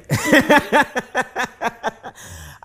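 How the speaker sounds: real laughter at the memory; loud high laugh. Real, not acted.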